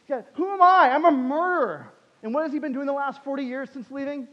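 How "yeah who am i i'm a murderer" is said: loud, high cry of self-doubt; anguished self-accusation. Retold or imitated. Preacher voicing Moses doubting himself before God, anguished cry. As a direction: wail it out as an accusation against yourself.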